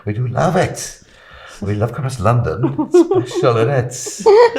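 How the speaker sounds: hammed-up Welsh accent ending high and loud; lilting Welsh tourist voice. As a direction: Welsh accent rising into a laugh. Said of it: "pretend to be Welsh".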